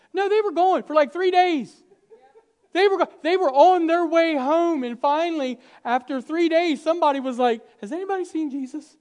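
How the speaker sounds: loud, high, driving sermon delivery; fired-up preaching. Acted. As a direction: build the story at full preaching force. Preaching at full force.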